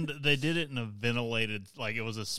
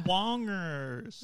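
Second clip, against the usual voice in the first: silly squeaky voice; 8 semitones higher than usual. Pretend. Silly catchphrase voice.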